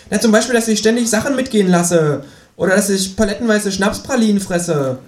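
high, outraged kangaroo voice; indignant kangaroo. Acted. Kangaroo protesting to its flatmate, high and indignant. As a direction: play the wronged kangaroo, pitched high.

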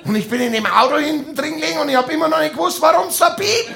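fast, high, laughing retelling; excited laughing. Real, not acted. Comedian tells the beeping car story, fast and laughing. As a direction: rush it out, giggling.

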